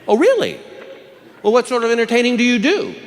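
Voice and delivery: high-pitched woman judge, mock interest; inquisitive judge. Judge questioning an entertainer in court, high and inquisitive. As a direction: lift the voice high and probe. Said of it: "the judge chimes in".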